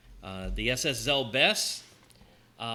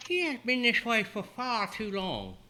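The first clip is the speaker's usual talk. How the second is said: put-on sweet high older woman; Calypso voice; 9 semitones higher than usual. GM voicing Calypso.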